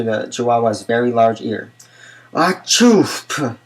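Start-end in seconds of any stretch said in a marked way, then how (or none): 2.3-3.6 high, sudden sneeze with a word tucked in; half-sneezed achoo. A narrator's reading. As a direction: sneeze it out, hiding the password inside. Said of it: "El Skipito half sneezed".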